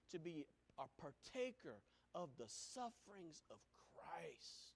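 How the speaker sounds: breathy intimate whisper; whispered truth. Real, not acted. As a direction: whisper it reverently.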